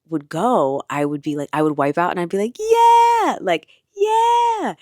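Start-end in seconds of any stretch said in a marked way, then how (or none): 2.6-3.4 high, loud whoop of joy; gleeful yell. Retold or imitated. Surfer whooping with joy after a wipeout, loud high yell. 3.9-4.8 high, loud whoop of joy; gleeful yell. Retold or imitated. Surfer whooping with joy after a wipeout, loud high yell.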